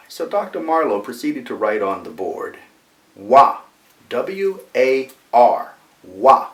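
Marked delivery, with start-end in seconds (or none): 3.2-6.5 loud, slow spelling in imitated Boston accent; Bostonian accent. Professor writing on the board, emphatic Bostonian accent. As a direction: hammer out each piece, Boston R dropped. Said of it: "he came with his Bostonian accent".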